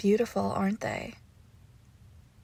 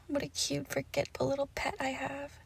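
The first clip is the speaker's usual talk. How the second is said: hushed, fond murmur, a touch higher; soft cooing; 4 semitones higher, 10 dB quieter than usual. Acted. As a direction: coo softly over a cherished little pet. Cooing over the pet softly.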